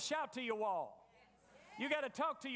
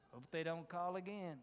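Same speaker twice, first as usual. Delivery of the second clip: low, soft mock sigh of tired friends; a hint of friends fed up with calls; weary friends; 5 semitones lower, 4 dB quieter than usual. Friends hanging up on a complainer, low mock weariness. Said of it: "all the friends say".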